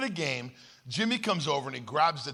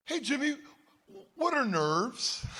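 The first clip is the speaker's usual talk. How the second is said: put-on high, slow, childlike voice; small boy puzzled by a grown-up word; innocent kid question; 10 semitones higher than usual. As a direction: ask it like a clueless little boy. Naive boy asking Jimmy.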